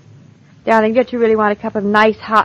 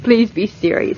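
normal talking voice

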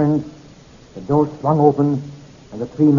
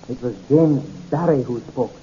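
usual speaking voice

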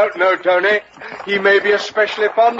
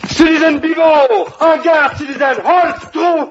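high-pitched military shout; urgent hail; 6 semitones higher than usual. Acted. Captain hailing Citizen Bivot on guard, urgent shouting. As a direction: call out to halt the guard.

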